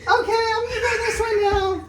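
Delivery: very high, loud pixie squeal; squeaky pixie voice. Acted. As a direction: play a tiny excited pixie. Pixie voice continues.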